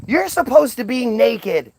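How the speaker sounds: high, slow, scandalised cry; shocked outrage. Retold or imitated. God sees Adam and Eve clothed, high shocked outrage. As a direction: gasp it out like a scandalised deity.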